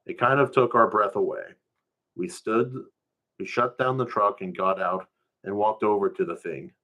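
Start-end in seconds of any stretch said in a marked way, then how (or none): none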